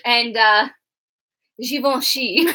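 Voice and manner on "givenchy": French word. Shown as a demonstration. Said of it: "here's what I can say in French".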